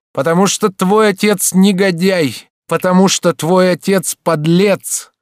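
slow, loud, high-pitched denunciation; hatred bursting out. A narrator's reading. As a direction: hurl each word out with hatred. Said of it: "не в состоянии сдерживать далее чувство ненависти". (громко, с ненавистью)

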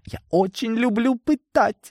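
put-on high, gleeful little-kid voice; childlike glee. A narrator's reading. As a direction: gush like a child over a favourite game. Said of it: "Словно маленький ребёнок, который увидел очень крутую игру". (восторженно, высоким детским голоском)

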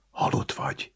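unvoiced secret whisper. A narrator's reading. Kriszta to Éva, who plays dead, whispered. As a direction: whisper it close into an ear. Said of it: "sugdosta Kriszta Éva fülébe".

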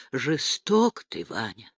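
slow, fading reproach; reproachful, dying away softly; breathed reproach. A narrator's reading. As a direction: sigh it out, hurt and accusing. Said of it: "укоризненно выдохнула".

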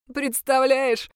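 understated impish amusement; amused demon. A narrator's reading. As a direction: tease like an imp enjoying its own joke. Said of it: "засмеялся бес".

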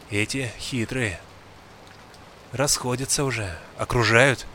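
put-on low, hushed old hunter voice; gruff old man, tense. A narrator's reading. Old man warns the boy. (старик, низко и напряжённо)

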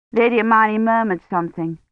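usual speaking voice

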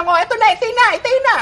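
mock high moaning "itay na"; high-pitched moan. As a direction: squeal it up high, teasing. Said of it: "Ganyan ka magsalita". (mataas na ungol na pabiro)

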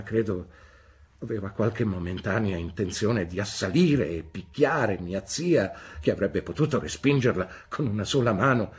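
ordinary delivery

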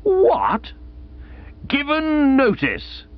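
high, slow aghast cry, given drawn out; stunned shock. A narrator's reading. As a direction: reel at the news. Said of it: "I own that I reeled".

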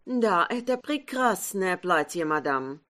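put-on light French accent in Russian; a milliner with a French accent. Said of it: "говорившая с французским акцентом". (лёгкий французский акцент)

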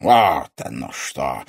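low, slow, interested drawl; intrigued villain. A narrator's reading. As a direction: let the realisation sink in slowly. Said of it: "заинтересованно произнёс незнакомец".

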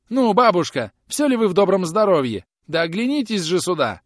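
high, loud beseeching; plaintive wailing. A narrator's reading. Groom begs offended old woman to turn back, wailing plaintively. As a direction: wail and plead for her to stop. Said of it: "завопил Роб жалобным голосом".

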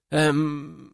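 drawn-out hesitant hum; stall, unsure what to say. A narrator's reading. Fighter handed a live grenade by commander, hesitant. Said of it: "замялся он".

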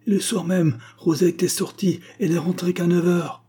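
high, triumphant delivery; joyful exclamation. A narrator's reading. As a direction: pounce on the damning fact gleefully. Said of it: "s'exclama joyeusement mademoiselle Lyle".

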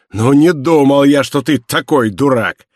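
loud, contemptuous exclamation; scornful shout. A narrator's reading. Inspector jeers at prisoner's plea, scornful shout. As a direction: jeer at him loudly. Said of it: "закричал Жавер".

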